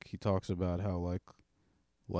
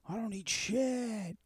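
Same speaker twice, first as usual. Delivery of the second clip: much higher and slow; high drunken boast; slower than usual. Retold or imitated. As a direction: stretch it out high and reckless. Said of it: "You're like".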